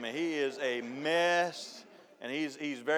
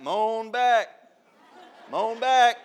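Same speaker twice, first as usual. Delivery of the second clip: loud call to the driver; 6 semitones higher, 8 dB louder, much slower than usual. Retold or imitated. Son guiding his dad's truck, loud calling out. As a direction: shout it to the truck up front.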